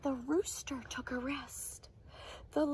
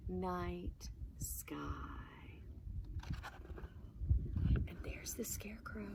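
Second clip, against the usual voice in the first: whisper; 4 semitones lower, 11 dB quieter than usual. Acted. Showing kids sleeping animals, soft whisper. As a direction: whisper so the animals stay asleep. Night ending, hushed.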